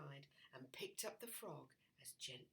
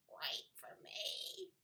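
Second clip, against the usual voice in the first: whispery frog voice; 11 semitones higher, 4 dB quieter, slower than usual. Acted. Frog calls after her.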